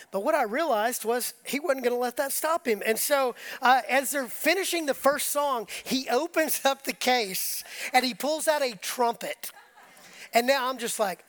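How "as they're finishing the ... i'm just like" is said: normal talking voice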